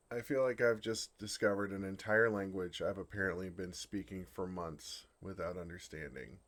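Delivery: usual speaking voice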